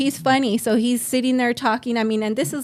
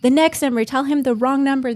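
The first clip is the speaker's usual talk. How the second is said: higher, louder mock self-urging; urgent inner voice; 5 semitones higher, 6 dB louder than usual. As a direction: push yourself to lie, higher and louder. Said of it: "And I'm like".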